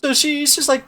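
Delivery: ordinary talk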